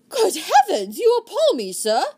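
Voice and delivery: high, loud shocked outcry; acted horror. Sir Philip feigning shock at news of rebellion, loud acted horror. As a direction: put on loyal horror for show. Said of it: "Sir Philip's loyal horror was beautifully acted".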